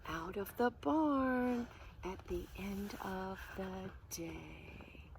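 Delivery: plain reading voice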